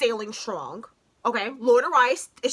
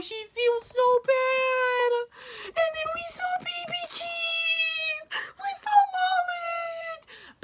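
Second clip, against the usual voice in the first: choked up. Real, not acted. Fan recapping the mom, voice cracking in tears. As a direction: voice breaking into tears.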